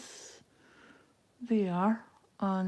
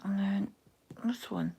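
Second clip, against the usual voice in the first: whisper; 6 dB quieter than usual. Real, not acted. Counting stitches under her breath.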